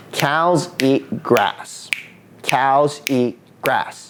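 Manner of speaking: "Cows eat grass" slow, one even beat per word; in rhythm. Shown as a demonstration. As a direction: space out "Cows", "eat", "grass" evenly on a steady pulse. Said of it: "let's try saying it in rhythm".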